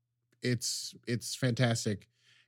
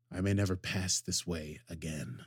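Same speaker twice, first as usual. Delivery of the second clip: portentous; 6 semitones lower, 6 dB quieter than usual. Retold or imitated. Picard telling Rafi why he must go, low portentous farewell. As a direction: lower and softer, grave and solemn. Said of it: "as he tells Rafi portently".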